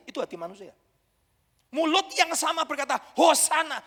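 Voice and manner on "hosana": loud, higher cry of praise; loud hosanna; crowd acclaim. Retold or imitated. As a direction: call it out like a cheering crowd. Crowd hailing Jesus.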